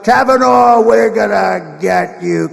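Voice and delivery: loud mock threatening shout; Schumer's threat. Imitating a senator on the courthouse steps threatening a judge, mock shout. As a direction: call it out loudly, imitating a threat. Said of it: "When you heard Schumer get up".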